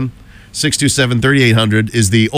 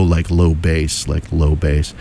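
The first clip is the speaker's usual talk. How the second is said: "low bass" dropped deep in pitch; 7 semitones lower, slower than usual. Shown as a demonstration. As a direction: sink into a bass register on "low bass". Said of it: "It would be a low".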